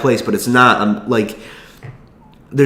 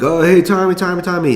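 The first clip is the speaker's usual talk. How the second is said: high, eager mock crowd chant; raised-pitch imitation of excited fans; fans calling out; 9 semitones higher than usual. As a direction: imitate fans hailing their hero. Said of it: "people are like".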